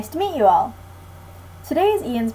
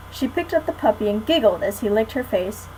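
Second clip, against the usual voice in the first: plain reading voice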